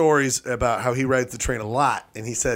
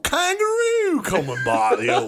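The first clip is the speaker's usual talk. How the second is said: put-on Australian accent; 7 semitones higher than usual. Bloke moans about Vietnam, hammed-up Australian accent. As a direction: stretch it out in cartoon Australian. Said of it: "he's Australian".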